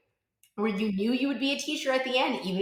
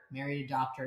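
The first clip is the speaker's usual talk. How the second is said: deadpan aside; 7 semitones lower, 8 dB quieter than usual. Real, not acted. Dry ironic punchline after retort.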